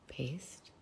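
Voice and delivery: unvoiced whisper while fiddling with the phone; whispering to herself. Real, not acted. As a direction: breathe the word to yourself. Fumbling with app on live.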